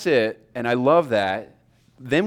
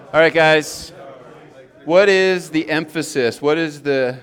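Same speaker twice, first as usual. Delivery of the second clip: louder, higher call for attention; calling the class back; 4 semitones higher, 6 dB louder than usual. Real, not acted. Teacher calling students back after an exercise, loud and raised. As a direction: raise the voice to gather the room.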